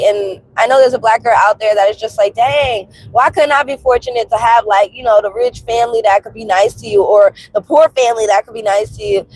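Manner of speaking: normal talking voice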